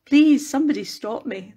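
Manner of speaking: ordinary talk, nothing marked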